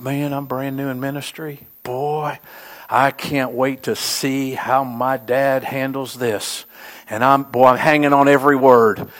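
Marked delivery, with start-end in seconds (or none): 0.0-6.6 hushed inner thought. Retold or imitated. Retold inner thought, new minister.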